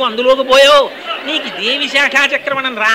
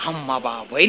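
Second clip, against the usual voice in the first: amazed gasp; 10 semitones lower, 8 dB quieter than usual. Acted. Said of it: "అని ఆశ్చర్యంగా".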